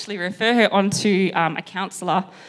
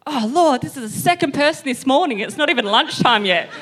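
louder, higher cry of dismay; mock exasperation; 6 semitones higher, 5 dB louder than usual. Trainer reacting to another crying client, comically exasperated. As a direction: play up the overwhelmed trainer.

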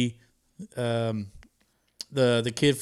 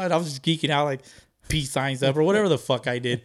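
normal talking voice

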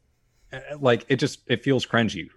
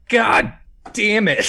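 louder higher curse; anguished curse; 10 semitones higher, 5 dB louder, slower than usual. Retold or imitated. As the dog dies in the film, a loud anguished curse. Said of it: "you're just like".

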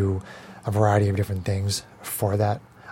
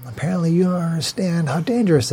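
mocking echo; 6 semitones higher than usual. NPC mocking player's line back.

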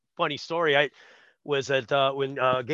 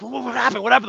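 high, urgent stammered questions; acted-out excited confusion; frantic scrambling; 8 semitones higher, 5 dB louder, much faster than usual. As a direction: imitate someone desperate to find out the news. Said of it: "we're scrambling for".

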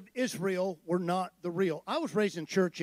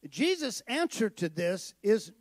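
ordinary delivery

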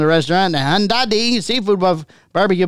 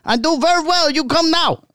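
put-on high, loud Chinese accent voice; 8 semitones higher than usual. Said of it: "They talk loud like this".

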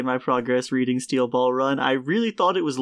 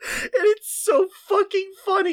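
laughing hard. Real, not acted. Laughing through the words.

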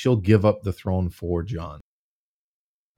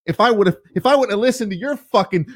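frustrated rant; 11 semitones higher than usual. Pretend. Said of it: "you're just like".

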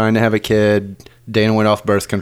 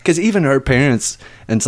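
normal talking voice

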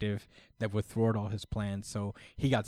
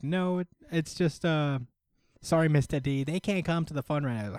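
high mock voice; 6 semitones higher than usual. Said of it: "someone is like".

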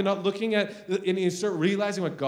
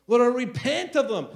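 higher, emphatic re-enacted plea; mildly raised prayer cry; retold screaming prayer; 5 semitones higher than usual. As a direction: act out crying to the Lord in repentance. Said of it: "I literally screaming in my car".

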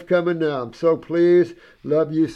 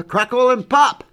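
higher and louder, bouncy; playful exclaim; 9 semitones higher, 7 dB louder than usual. Retold or imitated. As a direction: pop the words out with delight. Marvelling at her skin.

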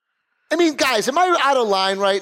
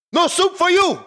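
higher, barked quote; Soup Nazi quote; 11 semitones higher than usual. Pretend. Quoting the Soup Nazi as a joke, higher barked refusal. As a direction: bark it like the soup guy. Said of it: "Soup Nazi quote".

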